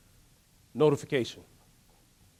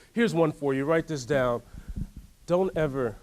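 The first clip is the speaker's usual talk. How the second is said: ordinary delivery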